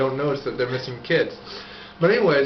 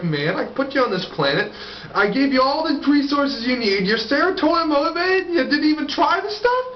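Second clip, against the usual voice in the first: pitched up and rising, offended disbelief; indignant God; 10 semitones higher than usual. Acted. Disappointed God scolding the dead soul who skipped the drugs, high and aggrieved. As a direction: play God whining that nobody tried his gift.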